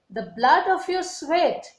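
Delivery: reads the poem aloud with feeling; poem recital. Acted.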